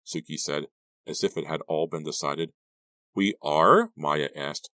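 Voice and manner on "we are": louder question rising high at the end; higher, eager query; hopeful surprise. A narrator's reading. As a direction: ask with hope creeping in. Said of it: "more hopeful than surprised".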